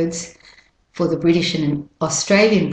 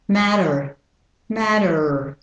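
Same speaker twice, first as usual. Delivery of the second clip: American "matter", T as D, er ending; American pronunciation; slower than usual. Shown as a demonstration. Teacher switches matter to American accent. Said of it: "with the American pronunciation".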